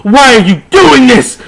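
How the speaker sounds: high loud hammed-up demand; overacted outrage; mock angry cry. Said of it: "Lupin said angrily".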